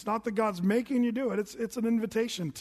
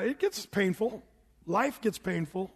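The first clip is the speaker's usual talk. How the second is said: usual speaking voice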